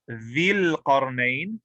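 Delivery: dhil qarnayn very slow, with ee in dhil; majroor form. Shown as a demonstration. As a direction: draw out dhil with its i vowel. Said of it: "majroor would be".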